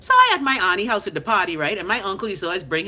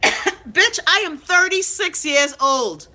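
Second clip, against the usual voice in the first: indignant retort; 7 semitones higher, much slower than usual. Retold or imitated. Answering relative about her pregnancy.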